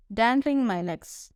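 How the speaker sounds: ordinary delivery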